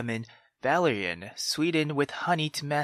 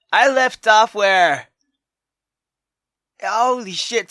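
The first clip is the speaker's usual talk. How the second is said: loud, high, rushed reacting; excited disbelief; 9 semitones higher, 10 dB louder than usual. Real, not acted. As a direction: freak out over the twist. Excited reaction to plot twist.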